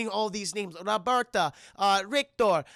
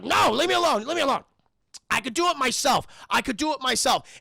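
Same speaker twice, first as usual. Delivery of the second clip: angry shout; 6 semitones higher, 7 dB louder than usual. Retold or imitated. Aggressive Logan.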